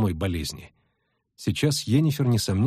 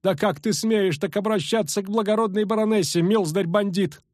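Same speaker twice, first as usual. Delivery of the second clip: brittle shout; 8 semitones higher than usual. A narrator's reading. Matron demanding respect from the bandit, high cracking cry. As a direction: cry out in a breaking old voice. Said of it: "крикнула ломким голосом дама".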